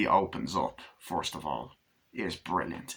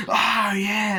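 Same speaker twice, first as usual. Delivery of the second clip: excited whoop; 11 semitones higher, 6 dB louder than usual. Acted. Thug whooping before the chase.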